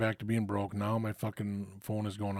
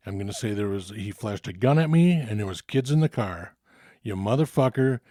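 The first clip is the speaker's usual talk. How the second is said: building to a louder threat, cursing; threatening rant; 7 dB louder than usual. Retold or imitated. Dealer threatening the thief over the phone, louder and angrier. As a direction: lean in harder line by line until it's a threat.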